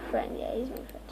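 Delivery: hushed whisper to himself; sneaky game whisper. Real, not acted. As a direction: whisper so nobody hears.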